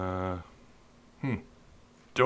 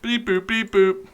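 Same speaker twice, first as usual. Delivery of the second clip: computer beeps; 8 semitones higher than usual. Pretend. Mimicking computer noises.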